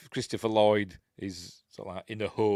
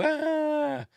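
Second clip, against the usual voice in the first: imitated scream; 5 dB louder than usual. Voicing villain's scream in film.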